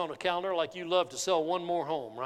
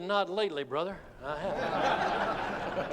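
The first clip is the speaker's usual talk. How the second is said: laughing; 2 semitones lower, 5 dB quieter, slower than usual. Real, not acted. Laughs at the tempting offer.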